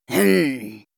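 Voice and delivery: low worried grumbling hum; colonel grumbling. Acted. The worried old colonel thinking, a low grumbling hum. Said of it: "grommela le colonel soucieux".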